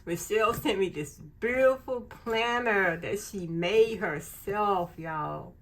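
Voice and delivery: usual speaking voice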